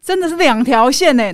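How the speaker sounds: normal talking voice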